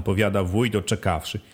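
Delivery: usual speaking voice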